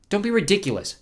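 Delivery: put-on high-pitched defiant boy. Boy defies villain in story.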